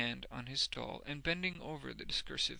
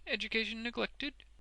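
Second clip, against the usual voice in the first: high, smooth question; self-complacent; 10 semitones higher than usual. A narrator's reading. Wegg asks about Boffin's schooling, self-complacently. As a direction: ask it with smug politeness. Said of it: "with increasing self-complacency".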